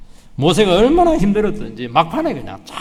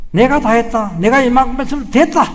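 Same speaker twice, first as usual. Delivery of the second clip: rising higher and louder to a sharp demand; indignant complaint; 9 semitones higher, 6 dB louder than usual. Retold or imitated. Proud servant protesting to God, building indignation. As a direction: build to an offended high cry.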